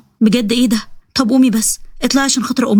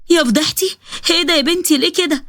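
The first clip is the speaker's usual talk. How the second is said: higher-pitched lamenting mother-in-law; scandalized dismay; 6 semitones higher than usual. Acted. Mother-in-law shocked by her daughter-in-law's photos, high dismayed lament.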